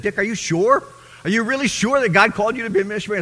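high, loud incredulous mother; doubting mother. Retold or imitated. Mother questioning whether God really called her shy son. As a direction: ask it like a worried mother who can't believe it.

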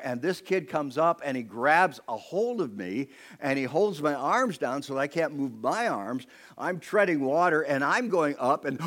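ordinary talk

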